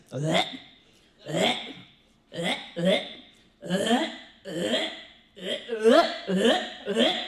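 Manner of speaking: a lightly voiced parrot bleating, starting slow and careful; parrot impression. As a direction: copy the bird's slow, cautious little bleats. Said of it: "perfect impersonation of what this bird does".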